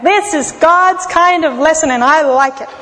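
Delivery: ordinary talk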